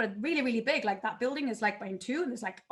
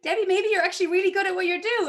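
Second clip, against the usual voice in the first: higher, louder voicing of her husband; higher, louder imitation of her husband; husband's voice; 7 semitones higher, 6 dB louder than usual. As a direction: play her husband, encouraging her. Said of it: "And my husband's like".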